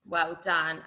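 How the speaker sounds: ordinary talk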